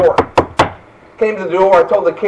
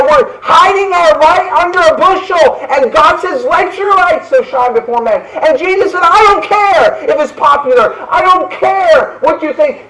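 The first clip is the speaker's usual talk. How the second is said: preaching at full force; 7 semitones higher, 3 dB louder than usual. Acted. Climax of the sermon.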